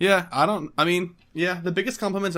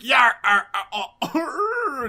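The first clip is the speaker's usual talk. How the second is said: mock sobbing; 10 semitones higher, 7 dB louder than usual. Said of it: "just starts crying".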